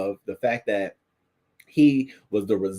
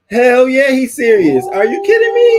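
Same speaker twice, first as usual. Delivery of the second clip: excited outburst; 12 dB louder than usual. Real, not acted. Reacting loudly to played clip.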